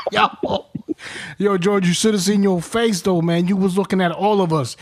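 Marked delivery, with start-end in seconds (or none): none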